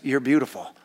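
ordinary talk

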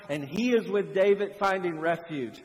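normal talking voice

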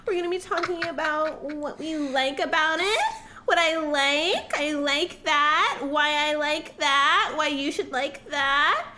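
hammed-up starlet with lifted endings; porn star voice. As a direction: squeal up at every phrase ending. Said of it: "the ending the words in, like, a high-pitched tone".

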